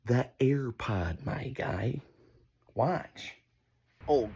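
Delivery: normal talking voice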